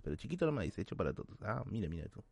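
ordinary delivery

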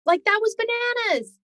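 high-pitched retold incredulous exclamation; amused disbelief. Imitating husband after the doctor's visit, high-pitched incredulous. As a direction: imitate astonishment, pitch leaping up. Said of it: "starts laughing".

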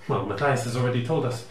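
ordinary delivery

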